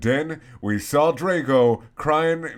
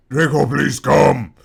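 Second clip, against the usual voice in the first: louder urgent call; pleading call, much louder; 7 dB louder than usual. Acted. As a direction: call after him across the room. Calling after running Draco.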